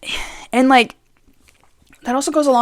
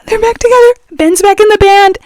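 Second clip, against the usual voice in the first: excited; 11 semitones higher, 5 dB louder, faster than usual. Real, not acted. Excited fan announcing reunion.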